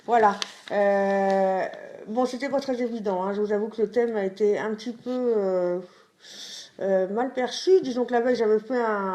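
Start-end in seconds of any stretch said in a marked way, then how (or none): none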